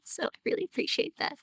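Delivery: whispered, fast, close to tears; teary. Real, not acted. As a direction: choke it out, almost crying. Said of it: "I'm getting a little teary".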